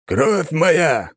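loud commanding drill call; shouting. Acted. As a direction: bark it like a sergeant leading an oath. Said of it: "громко кричал ратник". (громкий командный выкрик)

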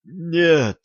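high, drawn-out cry of protest; attempted cry. A narrator's reading. Oleg tries to stop the men ahead, attempted cry. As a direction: try to shout, not quite getting there. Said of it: "попытался крикнуть Олег".

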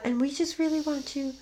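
soft Liv Tyler monotone. Retold or imitated. Mocking the actress's soft monotone for a friend. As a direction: drop into her hushed flat delivery. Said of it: "talk like this the entire time".

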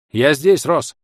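loud call from up high; shout from above. A narrator's reading. Warrior shouting down to Ros from above, loud call. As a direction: call down loudly to a friend. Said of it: "крик храбро долетел сверху".

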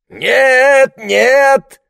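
high, loud, worked-up insistence; Larry insisting the snakes leave the house; agitated protest. Acted. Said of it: "волновался Ларри".

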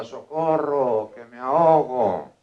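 loud, drawn-out call for help; mock-desperate, stretched-out shout; drowning cry for help. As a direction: cry out slowly as a drowning man in a joke. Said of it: "el tío chillaba".

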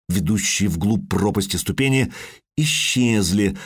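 plain reading voice

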